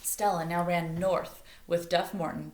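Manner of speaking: usual speaking voice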